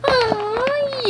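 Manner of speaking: very high cooing aw. Pretend. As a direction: coo adoringly. Toy coos.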